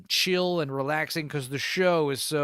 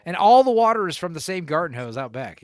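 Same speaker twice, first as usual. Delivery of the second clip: ordinary talk, nothing marked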